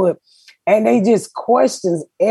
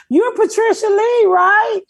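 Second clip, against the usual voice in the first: much higher, eager neighbor voice; imitating the neighbor; 13 semitones higher, slower than usual. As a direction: mimic the neighbor greeting her by name. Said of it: "He said".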